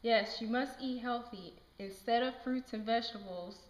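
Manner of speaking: usual speaking voice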